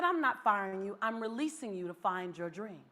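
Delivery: low, soft reassurance; gentle supervisor. Retold or imitated. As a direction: drop the voice and let them down softly. Supervisor gently correcting her.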